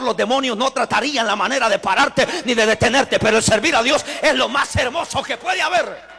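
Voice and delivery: loud, impassioned sermon delivery; full-force preaching. Real, not acted. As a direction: preach it out with rising intensity to a big finish.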